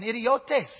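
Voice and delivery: lightly Spanish-accented Greek word. Shown as a demonstration. Preacher quoting Peter's audience on Peter, slight Spanish accent. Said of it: "only say that with a Spanish accent".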